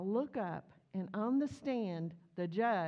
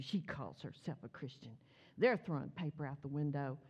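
ordinary delivery

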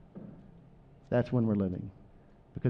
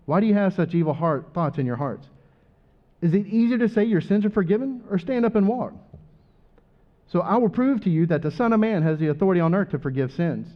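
raised, louder Bible storytelling voice; dramatic gospel reading; 6 semitones higher, 4 dB louder than usual. Acted. Pastor reads Matthew to the church, raised and fervent. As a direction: tell Jesus's story to the church with fervour.

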